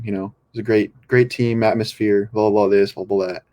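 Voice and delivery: ordinary talk, nothing marked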